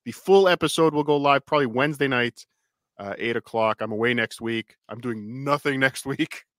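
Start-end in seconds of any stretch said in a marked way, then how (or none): none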